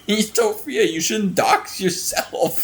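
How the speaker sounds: high, loud words through laughter; real fit of laughter; losing it laughing. Real, not acted. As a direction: laugh while getting the words out. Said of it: "I'm fucking losing it".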